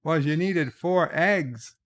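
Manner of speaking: hammed-up querying reply over the comm; starship crew. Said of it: "And they're like".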